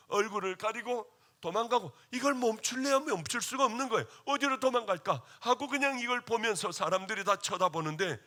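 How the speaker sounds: ordinary talk, nothing marked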